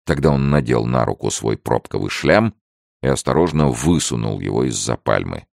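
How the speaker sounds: plain reading voice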